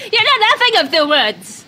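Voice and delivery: high, loud, outraged shout; shouting in face. Acted. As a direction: yell it right at them, pitch leaping up. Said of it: "shouting in his face".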